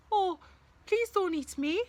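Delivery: high, pleading small voice; pleading little goat. Acted. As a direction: beg the troll in a tiny voice. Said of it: "said the littlest Billy Goat".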